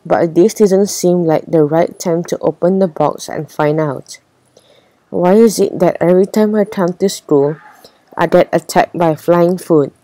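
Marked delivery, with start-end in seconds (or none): none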